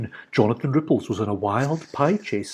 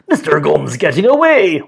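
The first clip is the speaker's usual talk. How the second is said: warning; 3 semitones higher, 6 dB louder than usual. A narrator's reading. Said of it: "warned Friday".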